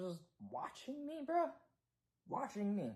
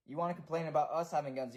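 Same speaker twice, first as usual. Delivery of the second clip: ordinary talk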